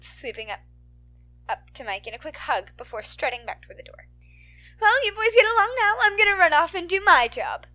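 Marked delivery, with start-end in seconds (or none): 4.8-7.7 high, loud teasing chatter; bubbly heroine voice. Acted. Midnight teasing Aizawa, high and bubbly. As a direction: tease him in front of the kid.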